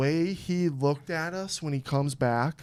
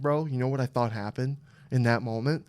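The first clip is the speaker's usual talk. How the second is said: ordinary talk, nothing marked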